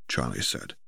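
ordinary delivery, nothing marked

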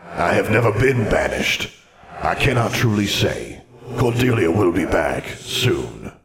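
echoing ghostly wizard voice; measured spectre with a hollow reverb; scowling ghost. A narrator's reading. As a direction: answer as a stern old phantom. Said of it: "The ghost scowled".

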